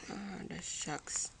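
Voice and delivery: whispered, slow disappointment. Real, not acted. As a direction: breathe the disappointment under your breath. Muttering at the game.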